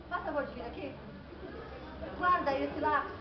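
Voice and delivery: very soft, controlled request; restrained teacher. Acted. Teacher handling a pupil with hands behind back, very soft restraint. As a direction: hold back and ask quietly.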